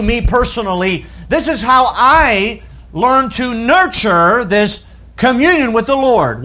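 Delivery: high, emphatic pulpit delivery; impassioned preaching. Real, not acted. Preacher voicing the psalmist's resolve to pray, impassioned preaching. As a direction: declare each resolve with mounting force.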